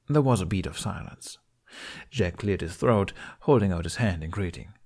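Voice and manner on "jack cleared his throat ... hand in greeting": ordinary delivery, nothing marked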